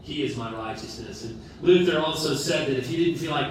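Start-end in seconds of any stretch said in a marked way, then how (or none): none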